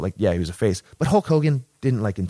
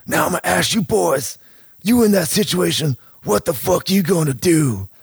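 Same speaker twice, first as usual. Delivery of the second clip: gruff challenge; 7 semitones higher than usual. Retold or imitated. Jake challenges the wrestlers on stage.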